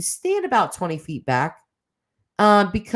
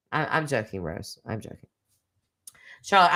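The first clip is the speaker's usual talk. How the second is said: ordinary talk, nothing marked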